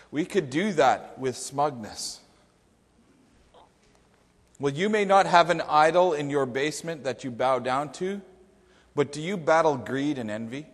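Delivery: ordinary talk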